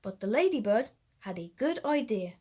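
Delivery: ordinary delivery, nothing marked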